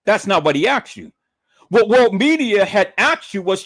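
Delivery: ordinary talk, nothing marked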